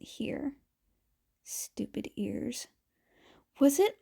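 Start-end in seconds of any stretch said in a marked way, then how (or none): 1.4-2.7 whispered under the breath; whispered grumble. Acted. Izuku grumbling to himself.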